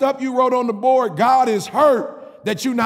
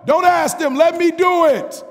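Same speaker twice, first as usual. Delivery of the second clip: God's voice, shouted; 9 semitones higher, 4 dB louder than usual. Acted. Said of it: "I heard God speak to me and say".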